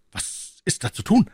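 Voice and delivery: high-pitched urgent question; young man asking for advice, taken aback; alarmed exclamation. Acted. D'Artagnan asking Tréville what to do, high alarmed voice. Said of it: "rief D'Artagnan".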